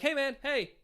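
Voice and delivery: soft, high-pitched call across the room; calling without yelling. Shown as a demonstration. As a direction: catch someone's attention across a room, kept quiet. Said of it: "without being super loud".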